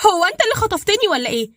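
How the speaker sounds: high, loud furious accusation; angry. A narrator's reading. Dalida to Badr, thinking he kidnapped her, angry. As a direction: yell at him for kidnapping you. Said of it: "متعصبة وقالت".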